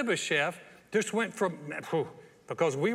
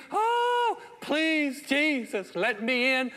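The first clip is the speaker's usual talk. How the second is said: high, loud wailing "Oh, please"; mock desperate plea; 8 semitones higher, 5 dB louder, much slower than usual. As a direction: beg at heaven's gate, hammed up. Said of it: "You don't have to scream".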